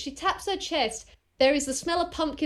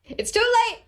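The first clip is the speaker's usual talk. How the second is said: raised, triumphant declaration; gleeful overruling; 3 semitones higher than usual. Acted. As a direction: cut off the protest with playful force. Aunt overrules the kid's protest.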